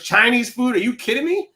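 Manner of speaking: incredulous rant. Retold or imitated. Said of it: "I'm like".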